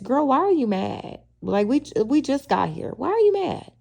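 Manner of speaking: mock baffled, pitching up to a high squeak on the last question; incredulous attitude. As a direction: imitate her going why are you mad at us. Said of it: "Kiana just gave very much".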